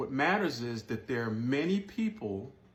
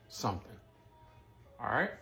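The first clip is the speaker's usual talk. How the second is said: ordinary talk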